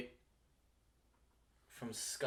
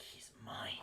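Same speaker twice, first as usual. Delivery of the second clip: hushed, whispered retold quote; soft, voiceless imitation of a besotted woman; possessive mock whisper; 12 dB quieter than usual. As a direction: whisper it as the smitten wife. Voicing the infatuated wife in retelling.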